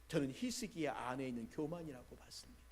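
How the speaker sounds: ordinary talk, nothing marked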